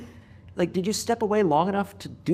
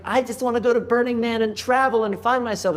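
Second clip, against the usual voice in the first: higher-pitched mock twenty-one-year-old; young woman impression; 6 semitones higher than usual. Host mocking would-be travelers, lightly voiced young woman. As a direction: lightly mimic a wanderlust-struck young woman. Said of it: "twenty-one-year-old girl that's like".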